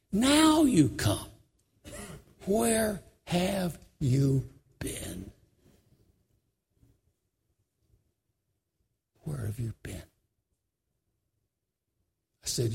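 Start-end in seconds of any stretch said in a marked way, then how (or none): 0.1-1.3 slow, hurt reproach, trailing off; a hint of a grieving man's reproach; grieving rebuke. Grieving man rebukes the preacher. 2.5-5.3 slow, aching question; slow grieving question; hurt question. Retold or imitated. Grieving man repeats his question. 9.2-10.0 much softer, lower, fading question; quiet, lower echo of the question; softer repeat. Retold or imitated.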